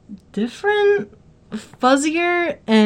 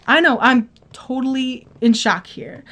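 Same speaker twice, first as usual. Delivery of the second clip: ordinary delivery, nothing marked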